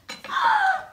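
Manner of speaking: sharp high gasp of wonder. Pretend. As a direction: gasp in mock shock. After trick disappears.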